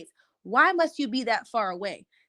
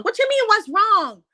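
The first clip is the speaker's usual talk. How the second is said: incredulous; 10 semitones higher, 6 dB louder than usual. Real, not acted. Outraged at the husband's question.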